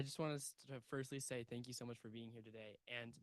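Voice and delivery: faint, off-mic; speaking away from the microphone; turned away from mic. Real, not acted. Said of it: "Can you, can you talk into the microphone like that?".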